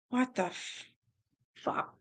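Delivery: hushed, appalled what the fuck; stunned disgust. Real, not acted. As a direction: barely voice your shock. Reader reacts in disgust.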